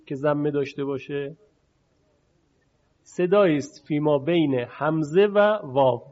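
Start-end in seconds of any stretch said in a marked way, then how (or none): none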